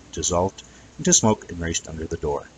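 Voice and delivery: ordinary delivery, nothing marked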